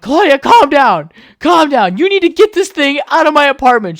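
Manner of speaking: high, loud frantic order; panicked yelling. Retold or imitated. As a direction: yell over a screaming roommate. Acting out panicked yelling at roommate.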